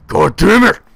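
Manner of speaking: loud, high angry yell; angry outburst. Acted. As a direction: curse out in pain and rage. Acted rage at stubbed toe.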